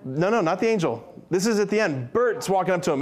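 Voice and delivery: ordinary talk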